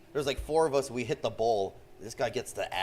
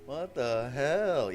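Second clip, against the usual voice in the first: stunned; 4 semitones higher, 4 dB louder, much slower than usual. Retold or imitated. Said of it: "I was just like".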